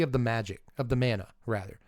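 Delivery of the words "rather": normal talking voice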